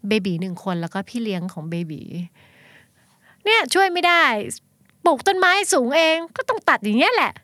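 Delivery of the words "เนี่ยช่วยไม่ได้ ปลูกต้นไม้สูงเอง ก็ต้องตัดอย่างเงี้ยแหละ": smug neighbour. Retold or imitated. Neighbour quips at her trimming the hedge, smug and higher. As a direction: toss it off with a smug little smile. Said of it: "คำพูดแรกในใจคือ เอาอีนี่".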